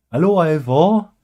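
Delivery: high, loud sing-song Welsh; Ivor the Engine Welsh accent. Shown as a demonstration. Presenter doing the guy from Ivor the Engine, Welsh accent. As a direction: hail him in a cartoonish Welsh accent. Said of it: "guy from Ivor the Engine in a Welsh accent".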